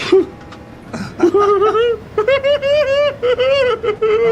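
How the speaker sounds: high, loud burst of laughter. Real, not acted. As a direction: crack up hard. Big laugh at donation.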